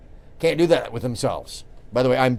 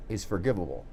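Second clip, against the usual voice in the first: usual speaking voice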